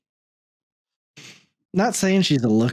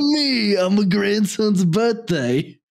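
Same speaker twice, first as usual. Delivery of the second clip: bad Trump impression; 5 semitones higher than usual. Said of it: "worst Trump impression I've ever heard".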